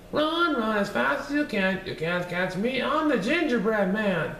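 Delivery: high, sing-song taunting cookie voice; bouncy little gingerbread man bragging; cheeky gingerbread man. Acted. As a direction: tease them from far out of reach.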